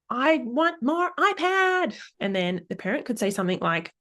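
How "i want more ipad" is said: put-on high, slow whiny little kid; mock child's whine, very high and slow. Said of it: "the child might say".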